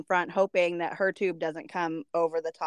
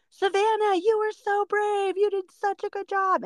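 put-on very high, sing-song praise for a small child; gushing praise; 13 semitones higher than usual. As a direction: imitate relatives cooing over a brave toddler.

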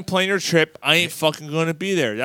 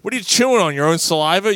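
higher, louder angry yell; 7 semitones higher, 3 dB louder than usual. Real, not acted. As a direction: rant at him loudly. Yelling at chewing cohost.

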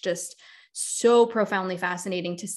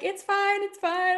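mock reassurance; 12 semitones higher, slower than usual. Reassuring embarrassed client.

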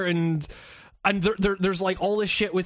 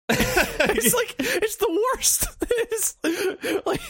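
high, squeaky laughing voice; helpless laughter; 12 semitones higher, much slower than usual. Real, not acted. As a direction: squeeze the words out through laughter. Cracking up at the game.

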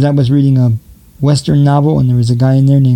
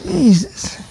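mock outrage; 8 semitones higher, slower than usual. Ranting at the governor.

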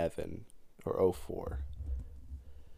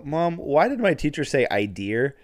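put-on high, loud puzzled child; retold third-grader's baffled question; imitating self as kid; 6 semitones higher, 7 dB louder than usual. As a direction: call out to mom, rising question. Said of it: "I just randomly go".

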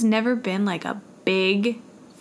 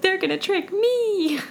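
high, slow, scared little voice; scared child voice; 10 semitones higher than usual. Retold or imitated. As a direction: fret like a nervous kid. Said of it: "I was like".

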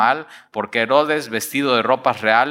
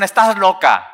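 loud, high scoff; mock disbelief, raised voice; 6 semitones higher, 6 dB louder than usual. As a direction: brush her off loudly. Disciples scoff at Rode.

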